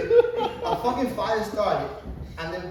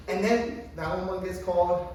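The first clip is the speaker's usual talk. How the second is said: usual speaking voice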